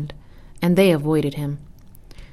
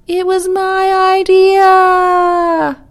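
very high, drawn-out yell; outraged explosion; 14 semitones higher, 9 dB louder, much slower than usual. A narrator's reading. Turtle claims credit as he lets go, outraged yell. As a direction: explode in a long, loud, high wail. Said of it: "exploded with sound".

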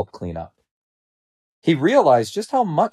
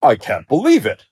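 loud, higher-pitched disbelief; shocked lion; 4 semitones higher, 5 dB louder than usual. A narrator's reading. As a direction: blurt it out in amazement. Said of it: "the lion said in shock".

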